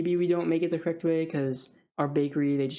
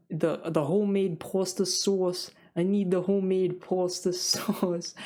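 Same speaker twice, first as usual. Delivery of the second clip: slow, higher-pitched fake Boston drawl; mock Boston accent; 4 semitones higher, slower than usual. Picky Boston customer ordering at a deli, mock Boston accent. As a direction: stretch it out in a bad Boston accent, cracking up. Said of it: "I don't know how Boston people talk".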